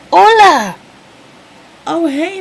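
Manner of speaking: high loud squeal of hello; high shouted hello. Acted. Bird lady greeting.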